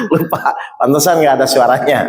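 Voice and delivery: low voice between real laughs; amused admission. Real, not acted. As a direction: own up to a slip while chuckling. Laughs at forgotten microphone.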